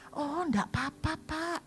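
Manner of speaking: high, sweet, overly polite voice; polite reassurance. Retold or imitated. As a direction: gush politely to the late visitor. Pastor politely reassuring visitor.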